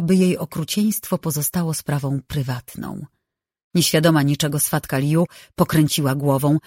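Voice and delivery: usual speaking voice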